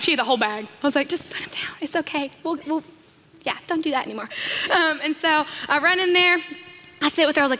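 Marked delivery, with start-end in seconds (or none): 1.1-4.3 hushed soothing. Retold or imitated. Soothing mother quietly.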